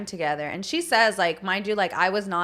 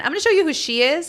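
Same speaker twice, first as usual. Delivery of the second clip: higher, louder and fast, eager; bursting to show the pictures; excited reveal; 8 semitones higher, 7 dB louder, faster than usual. Real, not acted. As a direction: rush to show her off.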